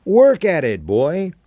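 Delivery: lightly voiced teasing old friend; Chico's voice. A narrator's reading.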